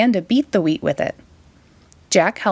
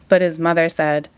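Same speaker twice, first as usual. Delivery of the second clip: usual speaking voice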